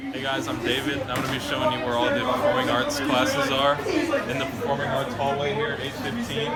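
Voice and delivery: loud upbeat host opening; tour host intro. Acted. David opening a hallway tour for new students, loud and upbeat. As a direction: greet viewers like a school tour host.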